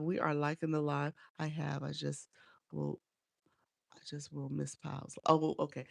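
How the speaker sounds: normal talking voice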